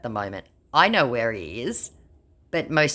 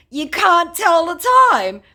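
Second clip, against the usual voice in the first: high, loud, slow taunt; mocking taunt; 9 dB louder than usual. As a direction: crow it at him. Taunting the scammer.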